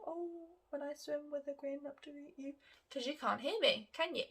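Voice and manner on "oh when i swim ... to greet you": mumbled softly, head down; quiet mumble. Shown as a demonstration. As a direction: mumble it shyly into your lap. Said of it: "quietly mumble".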